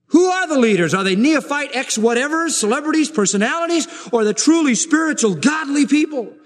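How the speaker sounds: high, ringing rhetorical questions; impassioned preaching. Real, not acted. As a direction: put the challenge to the congregation. Preaches at full force.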